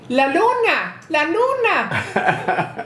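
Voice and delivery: put-on high, childlike cry of wonder; a little girl amazed at the light; excited child. Child excited seeing street light.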